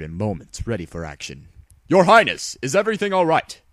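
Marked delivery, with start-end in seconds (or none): 1.9-3.6 much louder, higher, fast call of a guard; loud, urgent guard call; alarmed call. Acted. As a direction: rush in shouting concern.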